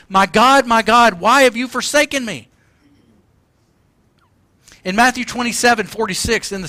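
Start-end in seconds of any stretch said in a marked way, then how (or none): 0.1-2.5 raised, impassioned preacher's cry; acted cry of the forsaken; loud cry. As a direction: cry it out loud, higher in pitch. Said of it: "Jesus cried out with a loud voice".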